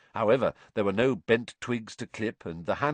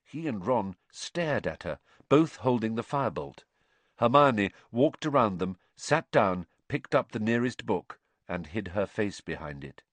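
ordinary delivery

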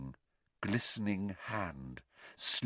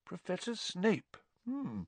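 soft musing teacher; thoughtful; 10 semitones higher, 8 dB quieter than usual. A narrator's reading. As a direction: turn the name over thoughtfully. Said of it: "looked thoughtful".

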